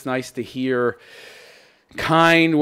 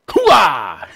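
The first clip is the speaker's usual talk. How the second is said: shouted military hoo-ah; loud gung-ho soldier; 5 semitones higher, 6 dB louder than usual. Acted. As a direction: bark it like a pumped-up soldier. Gung-ho cheer after intro.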